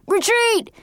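high-pitched battle cry of retreat; yelled. A narrator's reading. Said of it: "John yelled".

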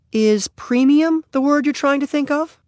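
very high, lilting motherly character voice; helpful mother. Acted. Mother suggests the word to her son, high and questioning. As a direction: offer it gently, pitched right up.

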